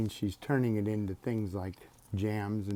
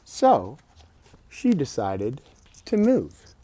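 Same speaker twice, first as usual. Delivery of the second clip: bright, lifted storybook voice; higher, louder read-aloud lilt; reading to children; 6 semitones higher, 8 dB louder than usual. Acted. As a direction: read it out like a bedtime book. Reading picture book aloud.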